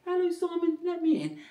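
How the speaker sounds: tiny high-pitched key voice; high mock whisper, fully voiced. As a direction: pretend to be a little key asking in. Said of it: "just the whisper".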